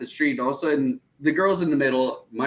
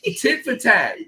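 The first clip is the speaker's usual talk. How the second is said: laughing. Retold or imitated. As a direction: laugh through the protest. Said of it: "I was like".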